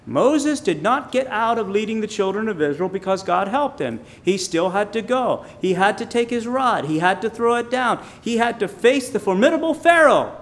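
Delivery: high, loud, building preacher's delivery; forceful preaching. Real, not acted. Preacher listing what Moses still had to do, forceful.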